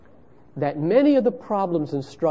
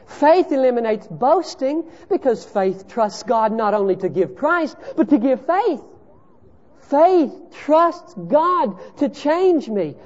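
high, loud pulpit preaching; preaching at full force; 13 semitones higher, 6 dB louder than usual. Acted. Preacher pressing the congregation on faith, in full voice. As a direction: drive the point home from the pulpit.

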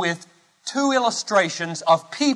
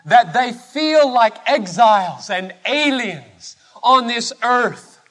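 high, loud, driving sermon delivery; forceful preaching; 5 semitones higher, 5 dB louder than usual. Acted. Preacher asking where the heavenly-minded people are, forceful preaching. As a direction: preach it with rising urgency.

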